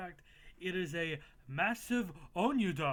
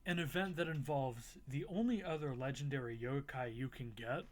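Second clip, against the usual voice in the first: ordinary talk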